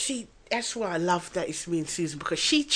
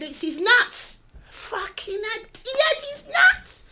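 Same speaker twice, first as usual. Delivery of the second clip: high, incredulous retelling; retold astonishment; 14 semitones higher, 4 dB louder than usual. Retelling the discovery about the cat, high incredulous exclamation. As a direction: pitch it up in mock astonishment. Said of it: "they said".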